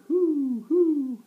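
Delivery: very high, loud hooting; high owl call. A narrator's reading. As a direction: hoot like an owl. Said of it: "the owl asking".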